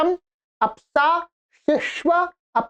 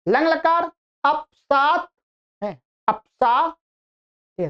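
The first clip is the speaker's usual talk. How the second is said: ordinary talk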